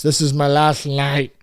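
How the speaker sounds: put-on slow, lightly slurred drunk vow fading off; a hint of a drunk man swearing it's his last night; drunk resolution voice. Host voicing a drunk New Year's vow, slow fading drunk voice. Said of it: "the drunk resolutions".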